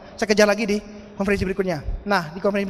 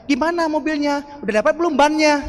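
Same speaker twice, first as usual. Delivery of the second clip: higher-pitched needling question; mock taunting; 4 semitones higher than usual. Doubters phone the speaker about his car, mock taunting.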